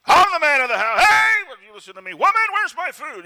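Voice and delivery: loud, high, barking domestic tyrant; husband shouting at his wife for food. Retold or imitated. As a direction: yell like a bully demanding his dinner. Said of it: "rule with an iron fist as tyrants".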